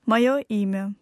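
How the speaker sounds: usual speaking voice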